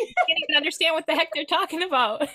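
high, loud, laughing and fast; amused exclamation. Real, not acted. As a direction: rush it out through real laughter. Joking about incomprehensible accents, laughing.

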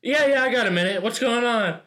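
loud casual chatter. Retold or imitated. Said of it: "they're just like".

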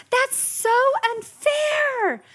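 high, slow, stretched-out whine; whiny kid. Retold or imitated. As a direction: play the sulky kid dragging out unfair. Child whining about unfairness.

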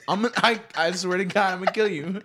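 hammed-up mock threat; mock murderous whisper-threat. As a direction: play up the rage for laughs. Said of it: "And I was like".

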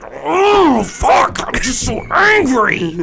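high, loud whiny outburst; hammed-up furious yell; mock angry shout. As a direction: shout it so the whole plane hears. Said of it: "That loud so everyone in the plane could hear".

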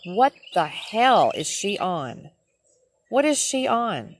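slow, drawn-out incredulous question; exasperated disbelief. Retold or imitated. Driver to trainer about the yelling rookie, exasperated disbelief. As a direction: act out baffled exasperation, stretching the words.

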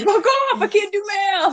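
loud frustrated outburst; yelling. Real, not acted. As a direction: yell it in mock outrage. Outburst after math is corrected.

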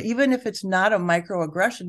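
ordinary talk, nothing marked

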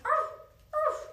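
high imitated little-dog bark; two short pretend barks, pitched high. A narrator's reading. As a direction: yap like a small dog. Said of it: "Teddy barked".

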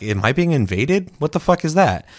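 mock alarm at an invasion. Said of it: "I'm like".

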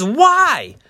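very high-pitched incredulous protest; high, loud disbelieving appeal; protesting Pilate. Acted. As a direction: plead with the mob, voice leaping up. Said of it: "And Pilate says".

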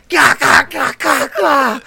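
loud high gah grunts; hammed-up slashing cries; mock battle grunts. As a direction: grunt like swinging a lightsaber. Anakin slashing noises.

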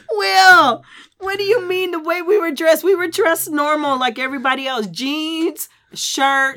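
loud, high-pitched objection; indignant protest. Real, not acted. Wife disputing husband's remark on their clothes, indignant. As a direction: protest loudly in amused disbelief.

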